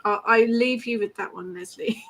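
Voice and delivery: ordinary talk, nothing marked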